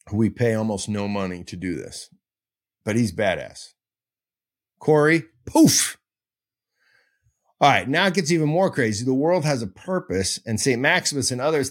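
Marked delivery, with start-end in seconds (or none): 4.8-6.0 calling for the poof. Shown as a demonstration. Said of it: "I want a standing poof sound".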